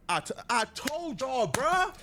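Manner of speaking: high, slow, stretched exclamation; incredulous vindication. Real, not acted. Host proved right by the message, incredulous vindication. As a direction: drag it out, climbing high at the end.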